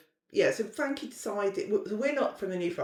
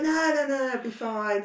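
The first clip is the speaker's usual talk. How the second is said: higher, louder brush-off; breezy dismissal; 6 semitones higher, 5 dB louder than usual. Retold or imitated. Daughter dismissing mother's doubts, breezily insistent. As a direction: wave the worry away insistently.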